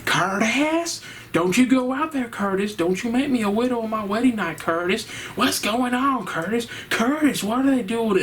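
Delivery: put-on high, whining Southern country accent, pleading with her husband; shrill country-accent whine calling her husband's name over and over; Connie's whiny country accent. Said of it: "always whining about something in that country accent".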